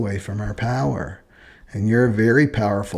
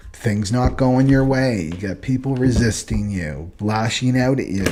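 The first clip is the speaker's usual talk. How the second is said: ordinary delivery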